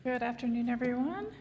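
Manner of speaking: hoarse, strained voice; losing voice. Real, not acted. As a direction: speak with a hoarse, fading voice. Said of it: "I'm losing my voice today".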